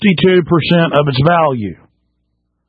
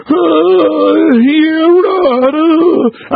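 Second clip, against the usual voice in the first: mocking a clueless guy; 10 semitones higher, much slower than usual. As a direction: squeal and fumble the words like a flustered dolt. Mocking a dismissive gun owner.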